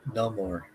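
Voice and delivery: Namor said as NOH-mor, long O; Namor pronunciation. Shown as a demonstration. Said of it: "That's how you pronounce it".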